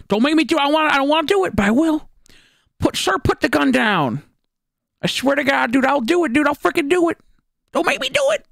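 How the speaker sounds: high alarmed mock police yelling; hammed-up panicked cop; mock cop standoff.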